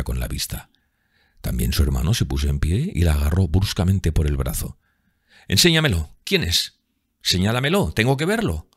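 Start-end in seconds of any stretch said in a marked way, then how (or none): 5.5-8.7 high, insistent demands; urgent exclamation. A narrator's reading. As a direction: demand to see him, pitch jumping up. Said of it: "exclamó". (exclamación apremiante, voz aguda)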